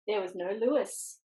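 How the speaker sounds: usual speaking voice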